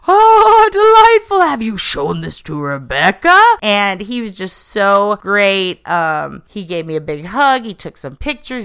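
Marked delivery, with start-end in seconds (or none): 0.1-3.4 high, loud, theatrical yell; Broken Matt Hardy voice. Retold or imitated. Fan recalls Matt Hardy yelling in character, high and loud. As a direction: boom it out like a grand eccentric character. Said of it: "in character yelled".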